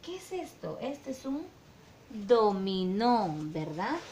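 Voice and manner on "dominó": drawn-out word. Shown as a demonstration. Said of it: "Con qué sílaba empieza dominó".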